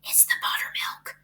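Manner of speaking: soft secret whisper; gossiping to the farmer's wife in a whisper. A narrator's reading. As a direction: lean in and whisper it. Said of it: "whispered Missus Arable".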